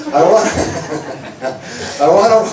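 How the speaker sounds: breaking up laughing mid-line. Real, not acted. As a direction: keep restarting through the giggles. Cracks up mid-line, restarting.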